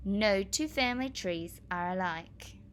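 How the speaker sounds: usual speaking voice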